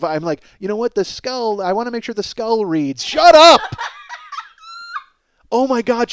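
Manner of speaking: usual speaking voice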